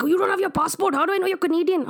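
high mock questioning dad; playful dad impression; dad's voice. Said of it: "He's like".

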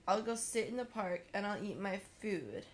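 normal talking voice

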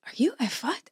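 imitating a whisper. Retelling a stranger recognizing her.

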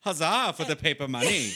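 loud, high "Huzzah" for the money; hammed-up medieval shout; mock vendor cheer.

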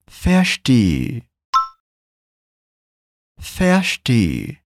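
Verstehe with the final e held longer. Shown as a demonstration. Teacher modelling Verstehe with a long final e. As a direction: stretch the last e. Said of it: "esse E no final é mais longo".

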